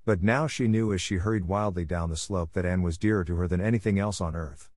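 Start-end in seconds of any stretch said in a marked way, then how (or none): none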